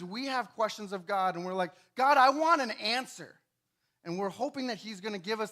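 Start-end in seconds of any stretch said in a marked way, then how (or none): 1.9-3.4 louder and a bit higher, demanding; demanding cry. Acted. Believers demanding an answer from God, loud plea. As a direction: insist on an answer.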